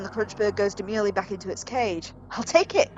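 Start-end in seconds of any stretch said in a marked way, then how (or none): 2.3-3.0 high, eager lady's exclamation; pitched-up, eager outburst; excited cry. Acted. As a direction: cry out in delight as the customer. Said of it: "cries the lady".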